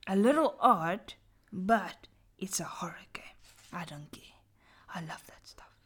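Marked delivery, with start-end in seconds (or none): 1.5-5.7 hushed spooky storytelling; dramatic whisper. Acted. Reading a horror story aloud to friends, whispered. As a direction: whisper it to give chills.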